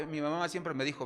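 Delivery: normal talking voice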